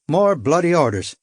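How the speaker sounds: Irish lilt. Acted. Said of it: "I couldn't place his accent".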